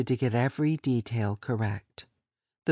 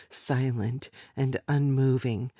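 ordinary delivery, nothing marked

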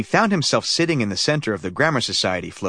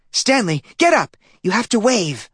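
very high, louder excited calling; excited urging; 10 semitones higher, 3 dB louder than usual. A narrator's reading. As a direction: hurry him up with giddy excitement. Mother urging Stanley up.

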